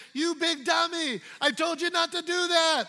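exaggerated scold as a fake quote; mock scolding Jesus. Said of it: "No, it doesn't say that".

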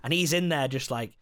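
normal talking voice

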